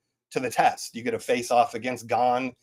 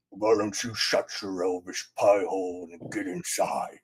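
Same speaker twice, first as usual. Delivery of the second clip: low, slow, deepened orc snarl; 5 semitones lower, 4 dB quieter than usual. Retold or imitated. Dev replaying his orc line to viewers, deep slow orc voice. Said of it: "that was the orc".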